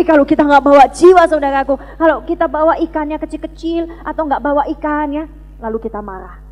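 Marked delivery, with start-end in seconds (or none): none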